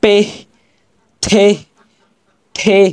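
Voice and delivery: পে, থে, ঠে each with a puff of air after it; h-ending drill. Shown as a demonstration. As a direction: recite পে, থে, ঠে singly, ending on h. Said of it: "এরকম একটা উচ্চারণ হবে". (পে, থে, ঠে-র শেষে হাওয়া)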